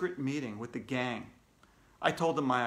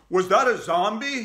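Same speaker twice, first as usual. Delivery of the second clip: raised, loud shouted question; alarmed shout; 6 semitones higher, 7 dB louder than usual. A narrator's reading. Mister Klutz running into the studio, shouting. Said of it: "he shouted".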